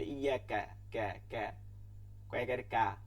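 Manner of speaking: light কা like Bengali, not throaty; teacher correcting কা; plain kaf demo. Shown as a demonstration. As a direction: keep কা light in the mouth. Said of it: "কাফকে কাফ করলেই হবে".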